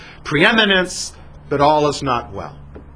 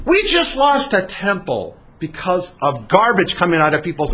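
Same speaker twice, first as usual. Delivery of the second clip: indignant rebuke; 8 semitones higher than usual. Acted. Gedaliah rebukes Yochanan's warning.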